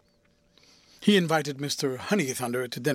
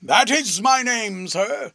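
louder, higher, slow and grand; pompous answer; 7 semitones higher, 7 dB louder, slower than usual. A narrator's reading. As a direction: announce your own name grandly.

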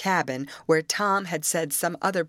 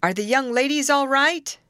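high, loud called-out question; shouting; 10 semitones higher, 6 dB louder than usual. A narrator's reading. Prisoner in a shack asking after the ladies, shouting. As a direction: call anxiously from far off. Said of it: "was a shout from a distant shack".

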